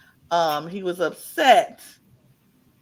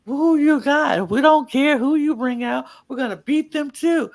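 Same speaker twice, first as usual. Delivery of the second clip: mock taunting; 6 semitones higher, 3 dB louder than usual. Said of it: "Sonya Deville was like".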